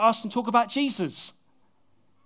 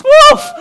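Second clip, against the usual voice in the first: laughing whoop; 20 dB louder than usual. Real, not acted. Laughing at his own accent.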